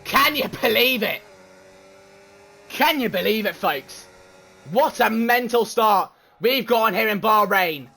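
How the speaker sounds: fast, lively play-by-play calling the race; race commentary. Acted. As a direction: call every overtake like a live broadcaster.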